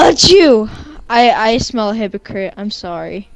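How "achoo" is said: loud hammy fake sneeze. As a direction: sneeze it out, obviously put on.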